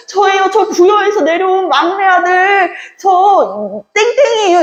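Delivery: high-pitched, excited introduction; acted son pleading to be recognized; eager long-lost son. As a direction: announce yourself eagerly in a high voice. Son eagerly introduces himself to father.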